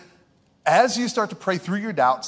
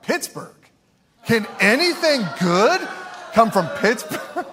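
mock scoffing local; 6 semitones higher than usual. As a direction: scoff at the town like a snob. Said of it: "it would be like modern day if we're like".

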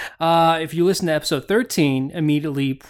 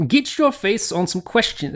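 a higher, half-hearted stab at an Irish lilt on the tagline; tentative Irish accent, pitched up; trying an Irish accent; 5 semitones higher than usual. Pretend. Host tries an Irish accent on the tagline, faint Irish lilt. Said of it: "Is that an Irish accent?".

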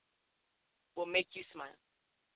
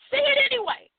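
the second "Say it anyway" jumps much higher and pushes harder; insistent repeat; 10 semitones higher than usual. Shown as a demonstration. Host urging listeners to speak up, insistent and higher. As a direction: lift it high and urge them.

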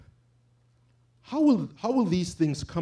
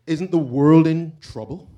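ordinary delivery

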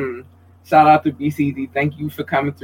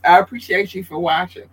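usual speaking voice

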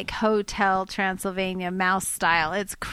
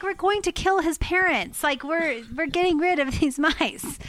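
higher-pitched retold parental argument; exasperated insistence; 6 semitones higher than usual. Mother telling kids the mice must go, exasperated.

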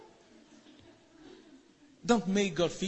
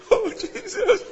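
high laugh; 13 semitones higher than usual. Real, not acted. Preacher laughs at crying.

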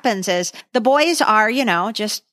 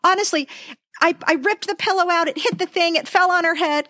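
earnest pleading; 7 semitones higher than usual. Retold or imitated. Replaying parents pleading with doctor.

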